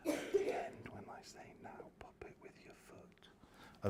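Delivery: breathy whispered instruction. Shown as a demonstration. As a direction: whisper it so only they hear. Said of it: "Now, I need to whisper".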